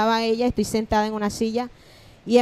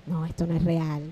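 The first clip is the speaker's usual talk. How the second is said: stunned disbelief; 6 semitones lower, 6 dB quieter than usual. Retold or imitated. Telling the interviewer how the prophet's words stunned her, hushed. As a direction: lower and softer, in shock. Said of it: "yo decía".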